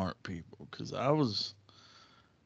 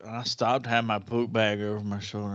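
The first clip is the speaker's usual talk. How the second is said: ordinary delivery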